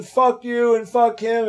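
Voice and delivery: high, loud, slow furious curse; angry girlfriend yelling. Retold or imitated. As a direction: spit "Fuck you and fuck him" out slowly, loud and high. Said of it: "she starts screaming".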